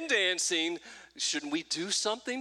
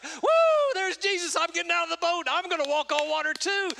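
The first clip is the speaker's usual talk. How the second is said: very high, loud whooping Peter; excited Peter; 12 semitones higher, 5 dB louder than usual. Retold or imitated. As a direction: play Peter thrilled to jump out of the boat. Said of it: "He's like".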